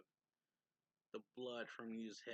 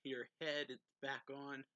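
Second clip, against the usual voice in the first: a lightly raised shout of shock; mock yell; 4 semitones higher, 7 dB louder, slower than usual. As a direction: call out his name, startled but not full force. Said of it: "Sheriff yells".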